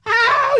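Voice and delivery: high yelped ow; sharp yelp of pain. Pretend. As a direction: yelp as if slapped hard. Slapped in the face.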